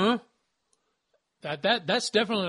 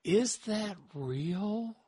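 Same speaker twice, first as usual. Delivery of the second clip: wondering aloud; 4 semitones higher, much slower than usual. Retold or imitated. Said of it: "when they make you say".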